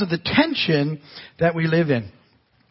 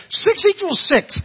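much higher, slow, firm insistence; insistent; 13 semitones higher, slower than usual. Real, not acted. Pastor insisting to the congregation, firm. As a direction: plant your feet on it.

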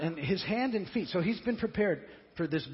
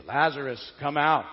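calling loudly into the tomb; a loud, commanding call to a dead friend; 3 semitones lower, 7 dB louder than usual. Shown as a demonstration. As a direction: call him out loud and strong. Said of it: "I didn't say it very loud, but you get the idea".

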